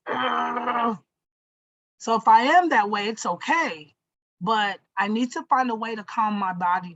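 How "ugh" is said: short frustrated shout of a grunt; what not to sound like, an angry ugh. Shown as a demonstration. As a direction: blurt out a fed-up ugh. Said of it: "I don't wanna be just".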